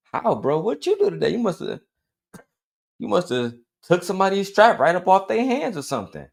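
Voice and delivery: high-pitched mock awe; mock admiration. Buddy grilling a self-proclaimed gangster, mock admiration. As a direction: act the gullible, impressed buddy.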